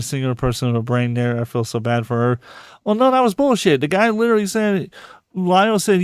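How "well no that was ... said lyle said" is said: heated. Real, not acted. Heated objection.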